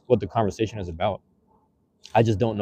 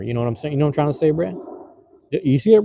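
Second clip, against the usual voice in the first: ordinary talk, nothing marked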